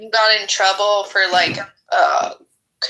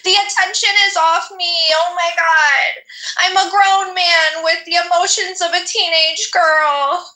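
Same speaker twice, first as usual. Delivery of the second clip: mocking melodramatic whine; 7 semitones higher than usual. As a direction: overplay a jealous teenager's tantrum. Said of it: "that's what you remind me of".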